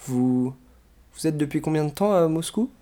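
hesitant, uneasy question rising at the end; a touch of a tremble, understated; trembling voice. A narrator's reading. Berlioz questioning the strange professor, mildly trembling. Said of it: "demanda-t-il d'une voix tremblante".

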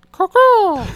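high bird-call whistle; chirping bird person. Retold or imitated. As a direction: call out like a crow, high and loud. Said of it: "he's like".

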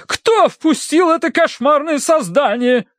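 high-pitched, slow indignation; theatrical outrage. Acted. Aunt demanding who let the dog in, outraged. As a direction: draw out the horror in a high matron's voice.

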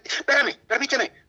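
high-pitched imitation of a brusque secretary; irritated mock yell. Secretary brushing off a young visitor, irritated imitation. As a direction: cut him off, high and sharp. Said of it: "qué gritó esa señora".

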